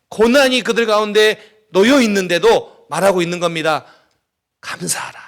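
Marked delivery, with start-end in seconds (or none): 4.6-5.2 near-whispered, much softer delivery; hushed exhortation. Retold or imitated. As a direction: drop to a hush, almost no voice. Same exhortation amid hardship.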